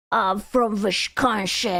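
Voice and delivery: slow, drawn-out attempt at a Texas accent; a rough Texas accent on request; mock Texas accent. Shown as a demonstration. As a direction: try out a hardcore Texas accent, slowly. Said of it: "Give me an example".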